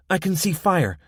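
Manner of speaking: high, loud excited call; excited girl. A narrator's reading. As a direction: point it out eagerly. Becky seeing fire.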